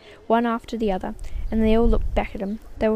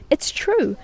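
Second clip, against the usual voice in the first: cried out; 6 semitones higher than usual. Acted. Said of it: "cried all four of the old people at once".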